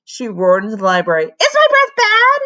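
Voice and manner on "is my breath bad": high, loud furious demand; raging demand, pitched high; demanding shout. A narrator's reading. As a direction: bellow the question. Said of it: "she demanded".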